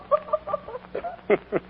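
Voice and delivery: high, soft giggle; can't hold back laughing. Acted. Laughs at Willie's hat.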